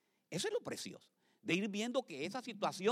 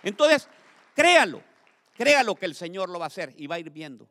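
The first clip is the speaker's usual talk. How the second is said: preaching at full force; 13 dB louder than usual. Acted. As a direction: exhort the congregation to believe.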